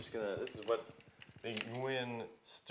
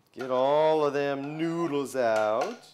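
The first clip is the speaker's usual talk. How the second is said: put-on southern accent; 8 dB louder, slower than usual. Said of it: "makes me talk in a southern accent".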